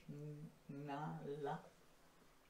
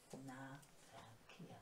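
quiet; 4 semitones lower, 19 dB quieter, much slower than usual. Shown as a demonstration. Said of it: "Aber nicht so laut".

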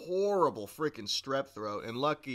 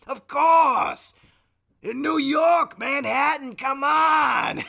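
higher and louder, a brash shouting cousin; put-on cousin voice; 11 semitones higher, 10 dB louder, slower than usual. Prank call to Blaine, higher, louder put-on cousin. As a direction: boom it like a long-lost cousin. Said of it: "the impression I'm doing".

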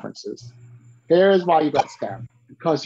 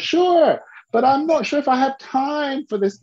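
busy rich woman; 9 semitones higher than usual. Retold or imitated. Voicing busy rich client.

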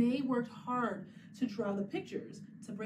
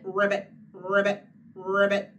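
lower, louder croaking ribbit; frog ribbit; 4 semitones lower, 8 dB louder than usual. Pretend. Teacher echoes a child's frog sound, croaking. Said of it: "You said".

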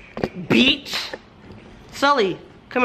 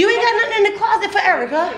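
laughing tease; 9 semitones higher, faster than usual. Real, not acted. Teasing friend, laughing.

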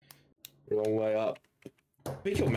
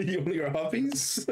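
put-on higher, giggling baby-talk tease; laughing baby talk; 6 semitones higher than usual.

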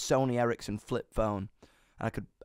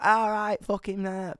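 higher, mock-cheery swagger; cocky greeting; 7 semitones higher, slower than usual. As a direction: put on a breezy chancer's greeting. Imitates own cheeky swagger.

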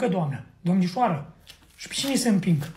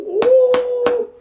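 long high, loud "uuu"; celebrating whoop; 11 semitones higher, 6 dB louder than usual. Acted. Husband celebrating the coming baby boy, high whoop. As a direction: let out a drawn-out high whoop.

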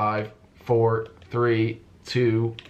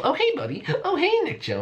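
very high sugary hello to his prey; fake-friendly greeting. Hunter sweetly greeting the player he is about to catch. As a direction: greet him sweetly like a sly predator.

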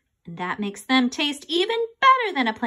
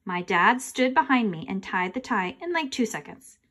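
ordinary delivery, nothing marked

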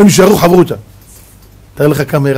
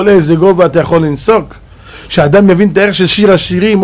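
ordinary talk, nothing marked

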